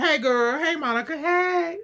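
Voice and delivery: sing-song fake-sweet hello; sugary greeting. [name] greets Monica sweetly.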